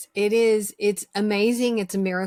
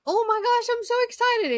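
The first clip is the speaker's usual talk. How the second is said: excited squeal. Retold or imitated. Acting excited self-talk.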